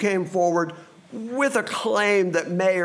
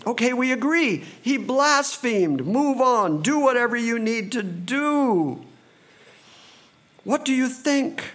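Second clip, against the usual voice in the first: weary council elders; 6 semitones higher than usual. Retold or imitated. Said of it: "those in the room who were thinking".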